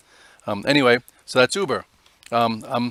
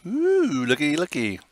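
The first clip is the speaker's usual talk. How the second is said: a high squeal of delight on ooh; pleased surprise; 4 semitones higher, slower than usual. Real, not acted. Spotting Clovis rising on the chart, delighted high ooh. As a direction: catch sight of a good chart and coo over it. Spots a stock moving nicely.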